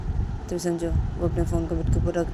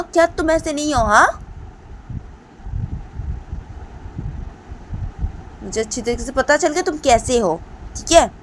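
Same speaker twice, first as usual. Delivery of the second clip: put-on much higher, louder girl's shouted rant; angry shouting; 12 semitones higher, 9 dB louder than usual. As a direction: yell at him to stop calling. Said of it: "तो दीपांता बोलती".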